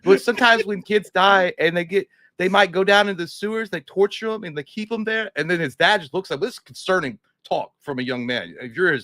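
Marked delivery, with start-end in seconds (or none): 0.0-5.3 put-on high nervous kid voice. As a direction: ask Dad like a creepy little kid, pitched high. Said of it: "He doesn't talk like that. I don't know why I'm doing that".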